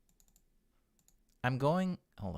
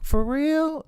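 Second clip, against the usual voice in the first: mock surprise; 11 dB louder than usual. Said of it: "And they're like".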